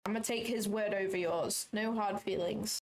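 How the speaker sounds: ordinary talk, nothing marked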